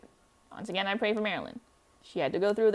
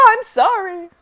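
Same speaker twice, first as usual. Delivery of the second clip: high, loud, crying apology; tearful cry; 11 semitones higher, 10 dB louder, slower than usual. Acted. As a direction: cry it out in grief. Grief over dead grandmother.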